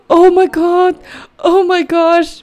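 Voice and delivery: high gasping cries of delight; freaking out. Real, not acted. Player holding the infant, gasping delight. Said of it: "why am I freaking out".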